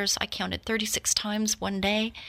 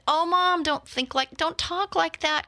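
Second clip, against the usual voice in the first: high, urgent protest to Mom; alarmed pleading; 8 semitones higher than usual. Retold or imitated. Daughter protests her mother's talk of dying, high and alarmed. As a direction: cry out, begging her not to say that.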